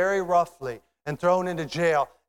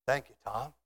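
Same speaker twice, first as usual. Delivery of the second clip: quiet thanks; 3 semitones lower, 5 dB quieter than usual. Acted. Acted stranger thanking him softly.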